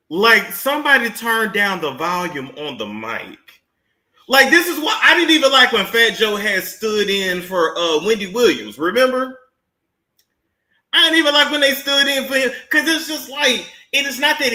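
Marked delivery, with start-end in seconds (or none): none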